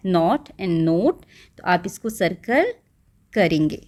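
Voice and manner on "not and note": not short, note long o, very slow; slow pronunciation contrast. Shown as a demonstration. Teacher slowly modelling not and note for the class. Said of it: "इन दोनों की sound different है".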